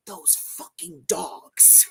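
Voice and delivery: slow, hushed EVP voice; ghostly whisper. Retold or imitated. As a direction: whisper it slowly, imitating a spirit caught on tape. Imitates man's voice on EVP recording.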